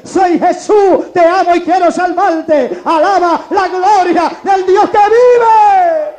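loud, slow revival shout; thundering, drawn-out exhortation; full-force preaching. Real, not acted. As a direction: build from proclamation to high, stretched praise cry.

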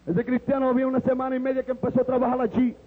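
normal talking voice

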